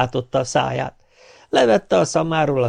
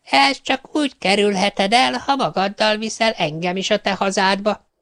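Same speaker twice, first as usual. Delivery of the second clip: a gleeful witch luring him to hell; 9 semitones higher than usual. Acted. Witch sets her condition. (magas hangú, gúnyos boszorkány)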